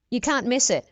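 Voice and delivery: ordinary talk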